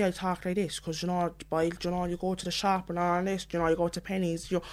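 thicker Cork twang, softly rattled off; emphasised Cork city accent. Shown as a demonstration. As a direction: talk like you're down the shops in Cork. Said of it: "emphasize a bit more on that".

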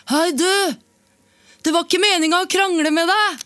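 high loud call across the water. Retold or imitated. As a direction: shout over to someone far away. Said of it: "roper han sånn her".

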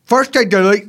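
loud bizarre English-American accent; put-on English-American hybrid accent; Andrew Tate. Shown as a demonstration. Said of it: "Can I do it in my accent?".

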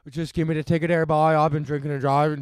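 quick, punchy mock Newfoundland accent; bad Newfie accent. Drunk driver asking police for a ticket, bad Newfie accent. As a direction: put on a rough Newfie accent. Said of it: "that's a pretty bad Newfie accent".

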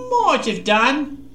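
hedging, unwilling to commit; noncommittal mumble. A narrator's reading. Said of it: "he mumbled noncommittally".

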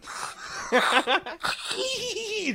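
a real high laugh bursting out; high squealing laugh. Real, not acted. Laughing at the bald guy's fake hotel room. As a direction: laugh hard at your own story.

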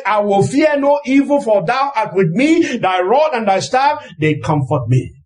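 ordinary talk

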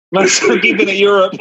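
cracking up while echoing the phrase; laughing. Real, not acted. Echoing the co-host's line back, laughing.

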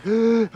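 high startled gasp at the stink; sharp gasp. Retold or imitated. Smelling the dead skunk in the trailer, gasping. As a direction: gasp in disgust. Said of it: "I'm like".

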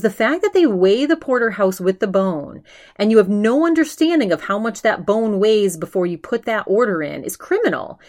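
imitating an outraged rant; mock indignant, pitch up. Ben ranting about the bony steak, mock outrage. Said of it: "he's outraged at the price".

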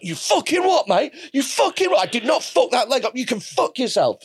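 high, indignant mock outrage; shrill-free hammed-up fury at the client; mock angry tattooist. Tattooist angrily retorting to client.

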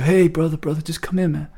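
put-on a mild take on Bill Clinton soothing a kid; gently mock Clinton calming someone down; Bill Clinton impression. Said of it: "That's a very good Bill Clinton".

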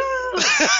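high-pitched laughter; real laugh. Real, not acted. Storyteller after mimicking the crying DJ, real laughter. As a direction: burst out laughing at the impression.

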